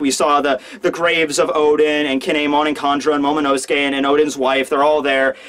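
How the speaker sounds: ordinary talk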